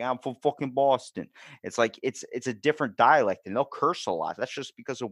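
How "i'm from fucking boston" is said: brash mock Boston accent, pitched up. Imitating someone from Boston, exaggerated Boston accent. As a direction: swear it like a Boston local. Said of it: "You get someone from New York or from Boston".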